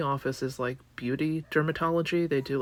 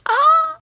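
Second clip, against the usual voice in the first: sudden high loud yelp; high mock shriek; 15 dB louder than usual. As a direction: shriek it like a cartoon fright.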